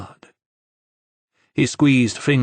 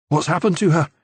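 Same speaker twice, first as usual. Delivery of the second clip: tense worried question; 6 semitones higher than usual. A narrator's reading. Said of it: "made Enzo tense".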